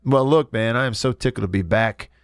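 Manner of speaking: ordinary delivery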